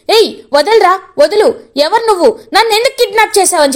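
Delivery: high, fast frightened yell; shouting in alarm. A narrator's reading. Kidnapped woman yells at her captor, high and fast. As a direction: cry out to break free from the kidnapper. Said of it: "గట్టిగా అరుస్తూ ఉంటుందన్నమాట".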